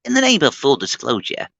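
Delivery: a lightly put-on reviewer voice; catchphrase voiced for fun; imitating a reviewer. Mimicking the reviewer's catchphrase for a friend, lightly put on. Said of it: "I love his full, like".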